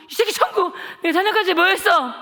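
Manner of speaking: loud, high threatening questions; bully the kids into answering; menacing interrogation. Retold or imitated. Schoolboy grilling classmates about church, loud and threatening.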